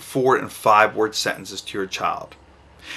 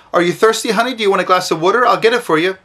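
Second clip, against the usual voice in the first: higher, chatty parent fussing; overloaded sweet question; 7 semitones higher, 4 dB louder, faster than usual. Shown as a demonstration. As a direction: fuss over the child with too many words. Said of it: "So you don't say to your child".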